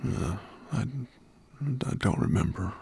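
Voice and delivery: put-on drowsy, faltering old dragon; hesitant, half-asleep mumble; sleepy murmur. A narrator's reading. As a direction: murmur it while drifting off. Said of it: "he murmured".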